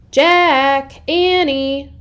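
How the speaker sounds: high, loud call from far off; distant call. Acted. Mom calls the children from a distance. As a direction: call their names from across the yard. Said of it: "a voice called from the distance".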